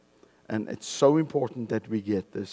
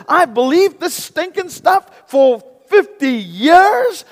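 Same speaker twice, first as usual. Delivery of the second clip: high, loud incredulous cries; outraged realization, hammed up; shocked disbelief; 12 semitones higher, 12 dB louder than usual. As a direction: play the stunned believer. Voices a shocked believer.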